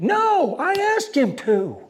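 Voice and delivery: high, loud retold objection; insistent protest. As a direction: imitate someone butting in loudly.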